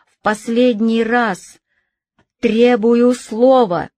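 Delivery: desperate shout. Acted. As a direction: demand it at full voice. Robespierre demanding to speak.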